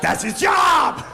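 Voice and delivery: high, slow shouted repeat; emphatic yell. Real, not acted. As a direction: hammer it home with a yell. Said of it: "repeat louder".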